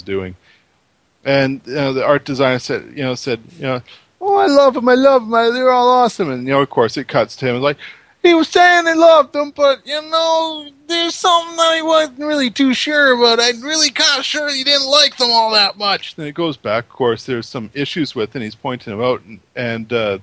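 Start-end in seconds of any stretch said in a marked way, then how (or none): 4.2-6.3 much higher, louder gushing fashion designer voice. Retold or imitated. Retelling the designer's praise of the cakes, gushing. 8.2-16.2 much higher, louder put-on designer voice; mock designer confessional. Retelling the designer talking to camera, put-on camp voice. Voicing the designer's cutaway interview.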